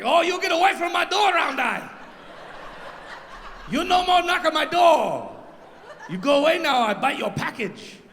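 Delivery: put-on high yappy Chinese accent; mock Chinese accent. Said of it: "with a Chinese accent".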